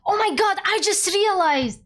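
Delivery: sudden excited gasp, high. Real, not acted. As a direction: blurt out the realization.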